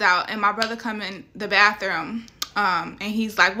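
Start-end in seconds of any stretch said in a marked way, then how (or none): none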